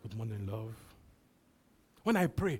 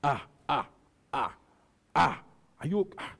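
doubting people. Retold or imitated. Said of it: "and people say".

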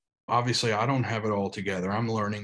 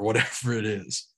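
ordinary delivery

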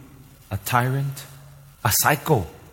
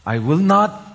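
"I will not" pitched high, leaning on "not"; strong emphasis; 6 semitones higher, slower than usual. Shown as a demonstration. Preacher repeating God's promise to congregation, emphatic. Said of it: "making the emphasis in the strongest way".

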